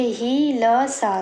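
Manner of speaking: ही ल सा broken into separate slow syllables; ही, ल, सा said apart, slowly; spelling out syllables. Shown as a demonstration. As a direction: sound out ही ल सा syllable by syllable for the class. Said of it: "तो देखिए".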